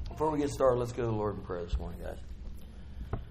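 ordinary talk

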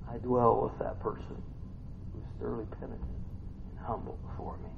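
quiet, intimate reassurance; hushed tenderness. Acted. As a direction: let it fall nearly to a whisper. God's tender turn after shouting.

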